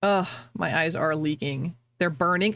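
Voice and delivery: ordinary talk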